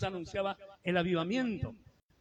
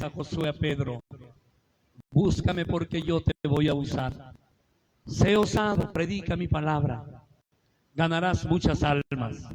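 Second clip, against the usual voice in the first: voice of God; 4 semitones lower, 5 dB louder than usual. Retold or imitated. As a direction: speak as God calling his servant. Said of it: "Y ahí vino su voz". (voz de Dios, más grave, lenta y solemne)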